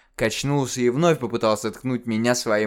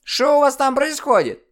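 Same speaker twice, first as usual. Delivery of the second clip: высокий старушечий ворчливый голос, громко; сыграй бабку, отмахивающуюся от крика; недовольная старуха; 6 dB louder than usual. Acted. Старуха-диспетчер переспрашивает. (ворчливая старуха)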